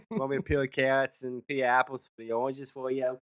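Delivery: lightly voiced fussing dad. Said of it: "It's my dad voice".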